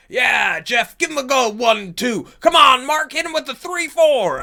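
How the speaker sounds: high, loud ringside shouting; cheering crowd. Acted. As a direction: yell them on like a rowdy fight spectator. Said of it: "you see them being like".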